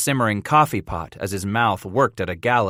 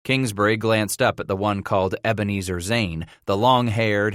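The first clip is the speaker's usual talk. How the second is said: usual speaking voice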